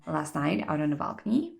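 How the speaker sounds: ordinary talk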